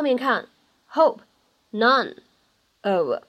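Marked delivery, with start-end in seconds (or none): none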